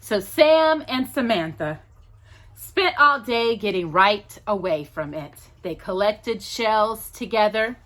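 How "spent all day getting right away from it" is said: ordinary delivery, nothing marked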